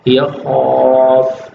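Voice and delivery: خوف said very slowly, stopping on the ف; pausal form. Shown as a demonstration. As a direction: stop firmly on خوف. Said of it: "وقف کرنا ہو تو". (خوف پر وقف، آہستہ)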